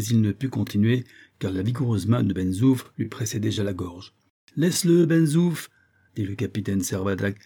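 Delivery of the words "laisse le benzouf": high, loud command; commanding shout; sharp order. A narrator's reading. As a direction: call off your man firmly. Captain orders his man off.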